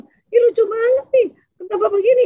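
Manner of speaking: high, delighted chatter with rising ends; wife squealing over a funny clip, high and excited; amused wife. Pretend. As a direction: gush over a funny video like a tickled wife. Wife acting amused at funny video.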